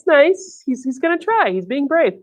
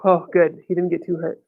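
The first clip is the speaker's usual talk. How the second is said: plain reading voice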